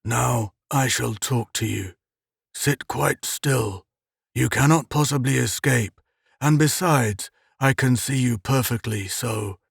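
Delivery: a lightly voiced uncanny captor; peculiar drawling voice. A narrator's reading. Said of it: "that drawling, peculiar voice".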